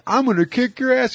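high mock drunken threat. Drunk guy threatening the kids, high hammed-up threat. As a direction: ham up the angry drunk. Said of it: "he's like".

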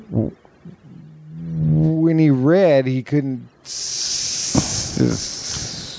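drawn-out stammer, stuck on the consonants; imitated stutter. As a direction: act out stuttering on the first sounds. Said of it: "have a stuttering problem".

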